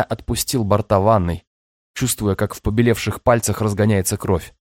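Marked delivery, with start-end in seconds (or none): none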